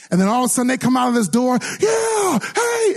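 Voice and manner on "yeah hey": hyped greeting. Retold or imitated. Preacher imitating staff bursting from the door, high.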